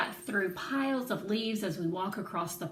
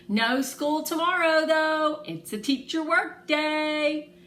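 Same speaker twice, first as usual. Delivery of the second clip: high, loud and delighted; gleeful news; 8 semitones higher, 7 dB louder than usual. Acted. Grinning girl tells friends of a day off, high and gleeful. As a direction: announce it grinning, thrilled.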